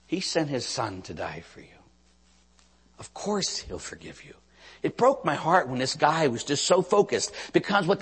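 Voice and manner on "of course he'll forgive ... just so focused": normal talking voice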